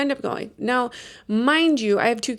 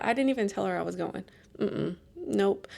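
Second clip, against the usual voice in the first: ordinary talk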